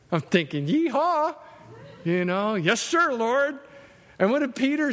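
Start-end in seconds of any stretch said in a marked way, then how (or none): 0.7-1.4 high whoop of delight; gleeful yeehaw. Retold or imitated. Preacher imagining eating catfish, a high gleeful whoop. 2.5-3.6 eager yes sir. Retold or imitated. Eager reply to heaven.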